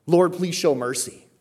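high, loud, slow entreaty; imploring plea. Acted. Believer today calling on the Lord, imploring. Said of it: "when you would utter something like".

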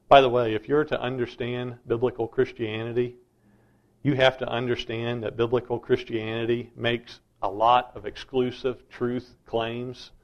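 normal talking voice

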